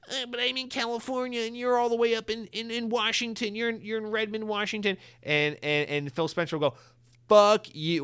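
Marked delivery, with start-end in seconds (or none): 0.1-5.0 very high, put-on protesting voice; whiny underling. Bought CEO objecting to the long trip, high mock whine. 7.3-8.0 loud, high dismissive shout; mock angry outburst. Boss refusing the CEO's excuse, loud mock anger.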